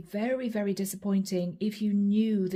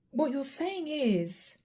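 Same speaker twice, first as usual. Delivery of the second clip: ordinary talk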